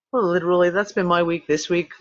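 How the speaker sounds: ordinary delivery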